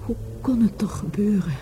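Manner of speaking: breathy stricken whisper; hushed grief. Acted. Woman hearing of her lover's death, stricken whisper. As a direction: whisper it, numb with shock.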